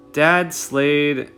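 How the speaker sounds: plain reading voice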